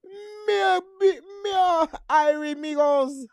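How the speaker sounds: high, loud mock Mexican Jamaican accent; sing-song Mexican Jamaican accent ad-lib. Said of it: "A Mexican Jamaican accent".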